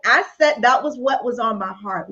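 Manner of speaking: normal talking voice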